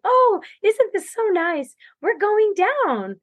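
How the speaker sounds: high, slow sing-song mock cheer; mocking cheery pacers. Pacers cheering her downhill, high slow mock chirpiness. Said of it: "my pacers kept telling me".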